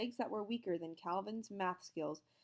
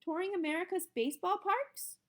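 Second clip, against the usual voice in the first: put-on child guessing; 12 semitones higher, 5 dB louder than usual. A narrator's reading.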